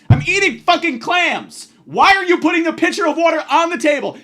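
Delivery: yelling, high and loud; shouting at the absent waitress; furious shout. Real, not acted. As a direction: blow up in outrage. Furious rant about water pitcher.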